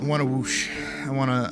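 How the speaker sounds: usual speaking voice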